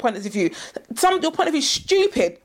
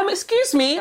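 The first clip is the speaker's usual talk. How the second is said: polite stranger; 6 semitones higher than usual. Retold or imitated. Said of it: "people are like".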